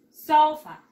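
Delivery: "Sofa" with "So" high and loud, then dropping; stress on O. Shown as a demonstration. Teacher repeating "Sofa" for a pupil, stress on the O. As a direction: punch the first syllable of "Sofa". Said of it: "la mayor fuerza de voz le vamos a dar aquí en la O".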